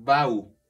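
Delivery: "bau" as one loud, blended syllable; joined syllable. Shown as a demonstration. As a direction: punch out "bau", both vowels run together. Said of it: "Aquí lo uno".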